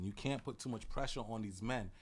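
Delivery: ordinary delivery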